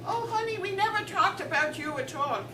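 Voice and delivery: high-pitched, mock-soothing wife; sweet put-down. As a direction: coo it high, then land the jab. Wife sweetly puts husband down.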